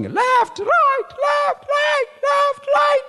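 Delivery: high shouted marching count; drill shout. Retold or imitated. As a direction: bark the marching count like a recruit on parade. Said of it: "you are supposed to be shouting".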